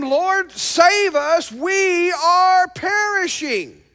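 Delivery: high, pleading cry for rescue; a frightened, crying plea; desperate plea. Acted. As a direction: cry out as if drowning. Disciples crying out in the storm.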